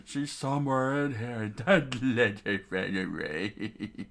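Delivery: sneering villain voice with laughs; cackling bandit. Acted. Bandit searching ruins for the hiding girl, cackling. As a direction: gloat and drag the last words.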